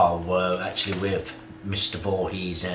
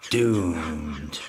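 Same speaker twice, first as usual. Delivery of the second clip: ominous doom voice; 4 semitones lower than usual. Acted. Ominous doom warning echoed.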